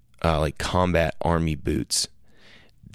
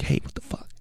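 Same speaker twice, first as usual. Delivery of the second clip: whispered complaint; 8 dB quieter, faster than usual. Retold or imitated. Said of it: "until I'm like".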